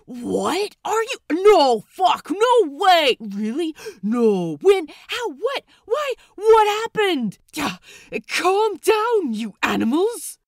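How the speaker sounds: frantic, very loud and high, overlapping yells; enthusiastic cacophony. Acted. Crew erupting at the gossip, loud excited yelling. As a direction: shout over each other in wild excitement. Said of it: "a gargantuan, in its enthusiasm, cacophony".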